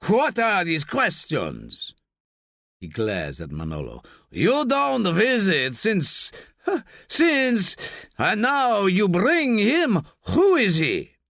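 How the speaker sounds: furious father demanding answers; indignant rising outburst, stuttering on since; angry old man. Acted. Rubio angry at son's visitor.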